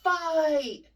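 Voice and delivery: the sluggish take. Shown as a demonstration. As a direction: drag the word like a slow arm. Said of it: "So if I say".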